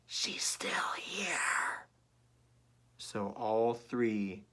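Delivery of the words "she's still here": very slow higher whisper. Acted. Baby Bear whispering to parents about the sleeping girl. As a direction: hush it like a secret.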